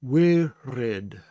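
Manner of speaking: Wirid with the R from the back of the throat; throaty Hebrew R. Shown as a demonstration. Teacher showing the throat R in Wirid. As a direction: roll the R in Wirid in the throat, not the tongue. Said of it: "I am saying that at the back of my throat".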